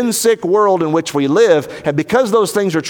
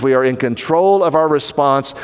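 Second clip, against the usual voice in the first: ordinary talk, nothing marked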